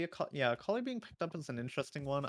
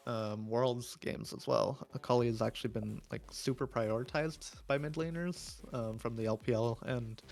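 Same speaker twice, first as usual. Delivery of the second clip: ordinary talk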